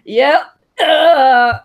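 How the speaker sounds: put-on loud, slurring brash drunk; brash drunken voice. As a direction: play it drunk and brash, slowly. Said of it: "brash, drunken voice on".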